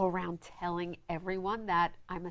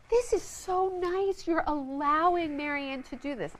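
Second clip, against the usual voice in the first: high, drawn-out gushing praise; mock admiration, pitched up; imitated admirers; 7 semitones higher than usual. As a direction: mimic people fawning over the husband. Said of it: "everybody gave, like, Uncle Lee all these accolades".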